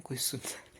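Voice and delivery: hushed whisper so nobody hears; real whisper. Real, not acted. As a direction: breathe the words out, no voice. Late night, others might hear.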